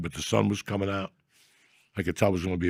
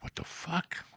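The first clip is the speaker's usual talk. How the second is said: hushed, breathy what-the-fuck; startled whisper; 8 semitones higher, 13 dB quieter, slower than usual. Retold or imitated. Waking to the cat's paw, startled whisper. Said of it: "I'm like".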